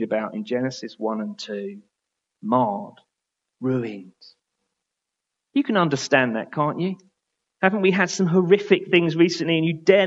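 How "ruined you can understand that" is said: ordinary talk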